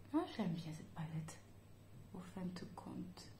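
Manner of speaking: hushed, letting viewers in on it; whispered aside. Real, not acted. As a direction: whisper it, like a little confession.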